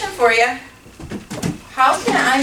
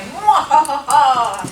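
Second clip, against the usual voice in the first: sneering panto villain; 3 semitones higher, 4 dB louder, much slower than usual. Retold or imitated. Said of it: "like Frankenstein in the lab going".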